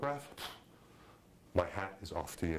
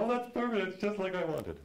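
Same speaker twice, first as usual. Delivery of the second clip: high-pitched gushing imitation; mock-gush far above your own pitch, loud at first; delighted director; 10 semitones higher, 3 dB louder than usual. Mimics delighted young woman director.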